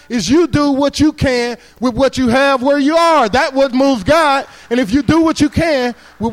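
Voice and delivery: the punchline delivered slow and loud; emphatic preaching. Acted. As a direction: proclaim each word to the whole room. Key line preached slowly.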